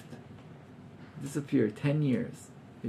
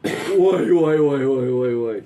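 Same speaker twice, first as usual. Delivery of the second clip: enjoyed shock; 7 dB louder, slower than usual. Acted. Townspeople gossiping over Naomi's downfall, mock-shocked lamenting. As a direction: wail a string of ois, relishing it. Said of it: "shocked but really enjoying being shocked".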